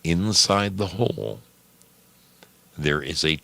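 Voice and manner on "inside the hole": ordinary delivery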